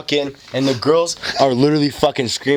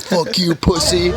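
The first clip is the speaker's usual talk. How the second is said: put-on drunk yell; 6 semitones higher, slower than usual. Drunk friend yelling while wrestling.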